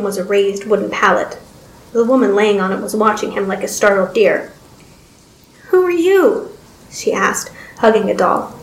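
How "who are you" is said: put-on high childlike voice; high-pitched girlish question; young girl, wary. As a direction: ask it as a timid little girl.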